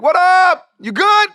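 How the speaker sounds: loud, very high mock holler; hollered greeting. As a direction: call it out big, like a hyped greeting. Retold loud greeting from a star.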